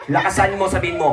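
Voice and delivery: ordinary delivery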